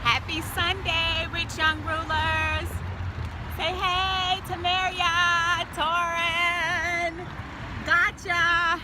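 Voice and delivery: loud, slow, cheery hello; upbeat vlog greeting. Real, not acted. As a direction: greet the viewers brightly.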